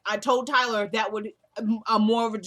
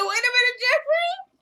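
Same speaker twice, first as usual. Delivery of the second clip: very high, loud squeal of disbelief; comic shocked outburst; 8 dB louder than usual. Retold or imitated. Viewer calling out Jeffrey's answer, high mock-shocked squeal. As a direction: shriek it in mock shock, pitched sky-high. Teller's shocked reaction to scene.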